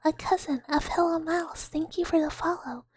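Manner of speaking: ordinary talk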